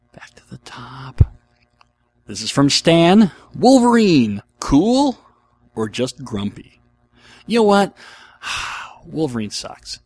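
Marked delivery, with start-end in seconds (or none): 0.1-1.3 slow hushed whisper. Real, not acted. Host aside about dice. 3.5-6.8 dramatic reading. Acted. Reading listener question dramatically. 7.5-9.1 exasperated. Real, not acted. Exasperated about Wolverine.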